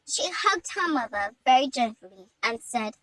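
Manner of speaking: plain reading voice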